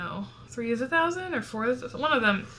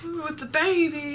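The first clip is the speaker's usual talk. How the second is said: cooing; 7 semitones higher than usual. Pretend. Cooing at imagined baby.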